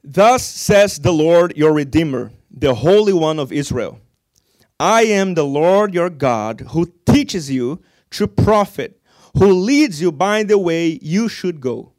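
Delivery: slow, loud, measured reading of the verse; solemn scripture reading. Acted. Preacher reading Isaiah to the church, slow and loud. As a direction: proclaim each phrase slowly. Reads scripture aloud with weight.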